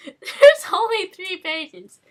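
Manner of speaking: loud, high, slow, laughing; laughing disbelief. Real, not acted. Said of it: "This is amazing".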